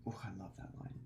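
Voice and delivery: very soft, breathy-low savoring; hushed savoring ooh; hushed delight. Real, not acted. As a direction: murmur it to yourself in awe. Savoring repeated remark.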